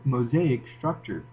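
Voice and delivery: usual speaking voice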